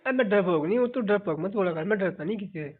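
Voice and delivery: ordinary talk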